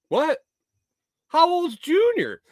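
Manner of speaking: very high-pitched mock disbelief; baffled, voice shooting way up; incredulous. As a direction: squeak the question out, stunned. Stunned reaction to breastfeeding story.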